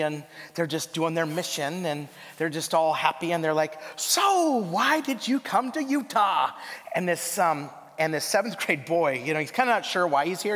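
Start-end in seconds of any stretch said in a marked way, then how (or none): 4.0-6.5 chirpy sister. Retold or imitated. Mimicking a sweet missionary sister.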